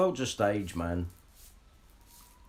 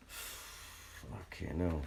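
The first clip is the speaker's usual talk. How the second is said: breathy dismayed sigh; 7 semitones lower, 13 dB quieter, much slower than usual. Real, not acted. Sighing after "wiped out.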